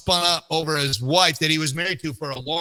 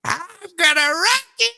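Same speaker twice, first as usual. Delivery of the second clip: put-on high, slow, cracking old woman; old lady voice; slower than usual. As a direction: stretch it out like a frail old lady. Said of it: "What do you think of my old lady voice?".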